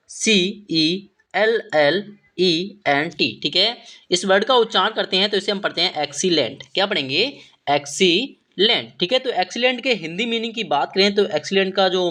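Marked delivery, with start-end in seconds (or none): 0.1-3.4 slow, spaced-out spelling; letter by letter. Shown as a demonstration. Teacher spelling the next word for students, letter by letter. 7.7-8.9 excellent split with a pause before the last syllable; slowly broken up. Shown as a demonstration. Teacher showing students how to read the word, slowly broken up.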